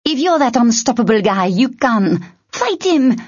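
high, insistent exhortation; urgent yell. A narrator's reading. Tern insisting Icy fight the puppeteer, urgent yell. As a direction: push him hard, climbing on the final words.

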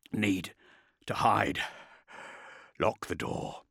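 wounded soldier struggling to speak; strained warrior. A narrator's reading. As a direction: force out each phrase with long pauses.

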